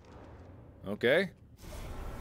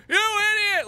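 high, loud cartoonish heckle; hammed-up viewer insult; mock angry viewers; 5 dB louder, slower than usual. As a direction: shriek it like an outraged commenter. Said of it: "you guys are like".

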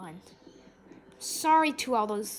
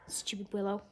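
ordinary talk, nothing marked

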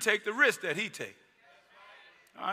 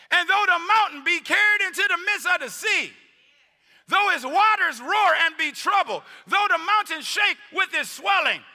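high, loud, slow emphatic scripture shout; reading with emphasis; 12 semitones higher, 11 dB louder, slower than usual. Acted. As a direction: proclaim the psalm with full force. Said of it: "now I'm gonna read with emphasis".

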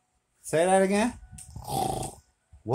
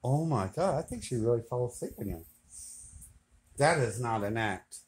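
hushed; 6 semitones lower, 10 dB quieter, faster than usual. Real, not acted. Quiet near sleeping girl.

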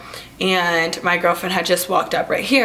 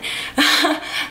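laugh; 6 semitones higher than usual. Real, not acted. Laughing at angry girl.

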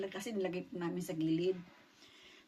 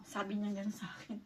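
ordinary delivery

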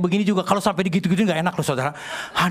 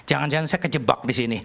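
low, softer thinking to himself; worried inner thought; 9 semitones lower, 4 dB quieter than usual. Retold or imitated. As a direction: voice a wary private thought, pitched low. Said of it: "saya mulai mikir". (membatin cemas dengan nada rendah)

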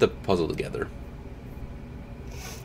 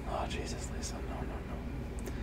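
whispered dread; 17 dB quieter than usual. Real, not acted. Whispering at Lisa not to buy the puzzle. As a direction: plead with the character under your breath. Reacting to character's choice.

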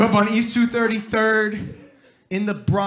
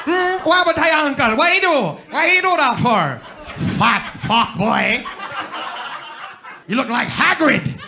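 put-on Jamaican kids' taunt; 6 semitones higher than usual. Jamaican kids mocking him after the Azkaban movie, teasing patois. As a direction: needle him about his uncle in patois. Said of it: "Next year, be like".

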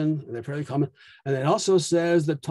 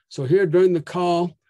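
ordinary delivery

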